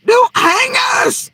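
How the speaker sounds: high, loud, crying mock plea; mocking whine. Mocking the family's plea to the cops, a high crying wail. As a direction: wail it like a pathetic mock victim.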